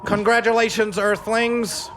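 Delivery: high, loud alien announcer. Acted. Alien greets Earthlings.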